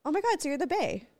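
light up at the news; delighted gasp. Real, not acted. Host gasping with delight at the caller's hometown. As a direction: gasp then a high excited oh my God.

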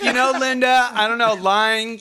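high, loud, preachy host; hammed-up moralizing radio host; mock Ryan Seacrest. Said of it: "He's like".

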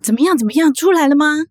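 can't wait for the answer; eager. Acted. Mother eager for exam results. (妈妈急着问放榜结果，兴奋急切)

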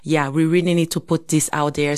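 normal talking voice

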